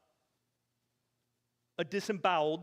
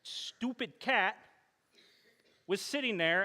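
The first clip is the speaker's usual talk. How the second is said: ordinary talk, nothing marked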